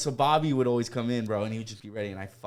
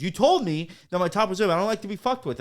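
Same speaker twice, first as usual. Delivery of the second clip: higher, louder retold protest; mock indignant coworker; 8 semitones higher, 6 dB louder than usual. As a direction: complain as the offended coworker. Acted coworker's angry complaint.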